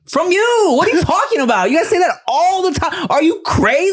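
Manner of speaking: very high-pitched, exasperated protest; mock incredulous outburst. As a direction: throw it back at them in squeaky disbelief. Retold outburst back at parents.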